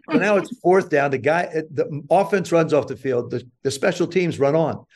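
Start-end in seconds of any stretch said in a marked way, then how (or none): none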